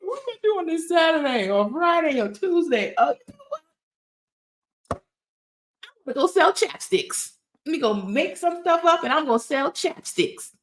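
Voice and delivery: high, breezy daydreaming voice; new business owner, high and excited; carefree entrepreneur. Retold or imitated. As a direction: toss out the idea on a whim. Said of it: "like, woke up one day".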